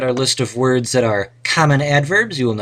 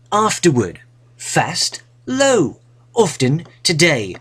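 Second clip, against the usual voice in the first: reading the words in a higher, put-on British accent; 5 semitones higher, slower than usual. Teacher reads the adverb list aloud in a put-on British accent. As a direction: play the posh British reader for the class. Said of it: "Perhaps with a British accent".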